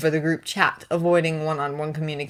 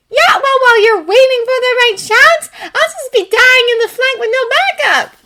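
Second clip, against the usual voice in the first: complaining gamer friend; 10 dB louder than usual. Acted.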